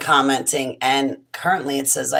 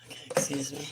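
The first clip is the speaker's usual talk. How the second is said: whisper; 3 semitones lower, 11 dB quieter, faster than usual. Real, not acted. Hiccup interrupts, whispered apology.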